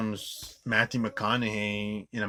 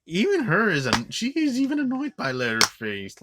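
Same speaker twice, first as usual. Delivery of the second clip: excited rant; 11 semitones higher, 7 dB louder than usual. Real, not acted. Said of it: "worst Leatherface".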